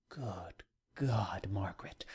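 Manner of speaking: awed whisper. A narrator's reading. Writer exclaiming to his sister Margaret, in an awed whisper. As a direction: breathe it out in astonishment.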